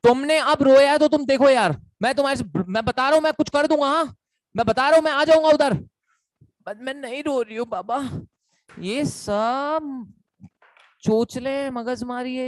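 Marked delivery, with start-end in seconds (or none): 0.0-5.7 louder, higher, urgent pleading; mock-frantic boyfriend swearing he'll come over. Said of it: "बंदा". 6.7-8.0 softer, put-on girl voice faking tears; lightly hammed-up girlfriend pretending she isn't crying; mock sniffly denial on the phone; mock teary girlfriend. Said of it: "सब acting चल रही है boss".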